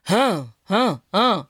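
low haughty huffs; snooty aunt impression. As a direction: huff like a bored grande dame. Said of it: "It's more like".

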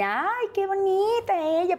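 high, slow, cooing doctor voice; sweet doctor. Retold or imitated. Doctor cooing over the first-time mother, high and slow. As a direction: coo it like a patronizing doctor. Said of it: "Ella toda dulce, amable".

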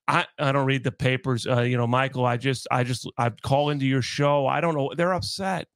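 low, dopey mumbling impression; mock Hal Steinbrenner. Host mocking the owner's interview, low dopey mumble. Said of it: "Well, Hal".

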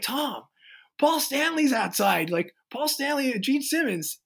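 high, breathless retelling of big news; telling the producer a star is outside, playfully; excited news. Retold or imitated. Band telling their producer the stars are outside, mock excited. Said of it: "we're like".

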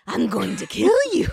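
sweet cartoon villain dropping into menace; evil turn. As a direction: drop from fluttery falsetto to an evil threat. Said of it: "fluttery and evil".

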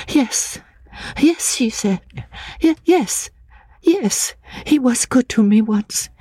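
put-on high, hesitant girlish voice, stammering; half-frightened, eager to agree; timid young woman. A narrator's reading. Anne answers timidly.

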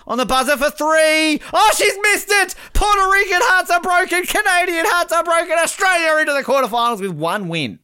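high, loud play-by-play of the final seconds; excited live commentary. Acted. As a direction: call the last seconds like a breathless commentator. Buzzer-beater miss, live call.

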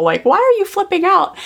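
retold high-pitched disbelief; mock exasperation, pitch jumping up; exasperated. Mother thinking to herself about the fit, exasperated.

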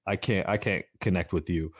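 ordinary talk, nothing marked

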